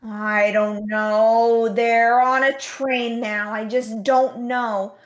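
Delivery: hammed-up elderly husband answering his wife; elderly moviegoer. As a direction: drag it out like a baffled old man. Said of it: "the other person would be like".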